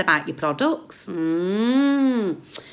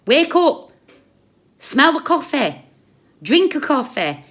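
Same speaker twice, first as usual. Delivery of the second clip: much higher, louder, slow furious yell at the subs; raging call to wake up, much louder; angry shout; 8 semitones higher, 7 dB louder than usual. Real, not acted. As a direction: shout them awake. Angry rant at viewers.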